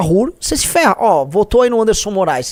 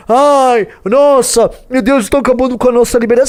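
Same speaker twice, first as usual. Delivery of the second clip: higher, louder hammed-up lament of a self-pitying voter; mock despair, raised and loud; mock whining complaint; 5 semitones higher, 5 dB louder than usual. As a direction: whine it like a sulky right-winger, mocking. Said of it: "o direitista reclama".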